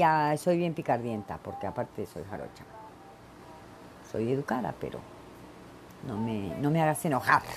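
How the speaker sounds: usual speaking voice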